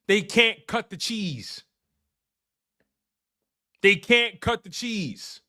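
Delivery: slow, drawn-out joke delivery; slow punchline. Real, not acted. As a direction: savour the pun word by word. Repeating the punchline slowly.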